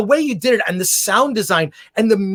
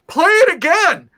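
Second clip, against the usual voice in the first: mock scream, pitched way up; imitated screaming fan; 11 semitones higher, 5 dB louder than usual. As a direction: holler it like a fan demanding an encore. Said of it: "I remember someone screaming".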